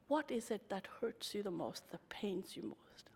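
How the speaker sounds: dropping to a whisper; gentle hushed question. Retold or imitated. Asking hurt women gently, in a whisper. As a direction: ask softly, almost breathless.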